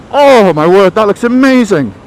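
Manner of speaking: loud, high gasp of wonder; awestruck delight. Acted. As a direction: gush over it, hammed up. Hungry man sees amazing cake.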